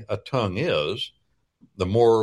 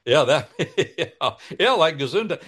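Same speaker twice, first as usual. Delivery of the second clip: amused laughing; 6 semitones higher, faster than usual. Real, not acted. Laughs at the Gesundheit joke.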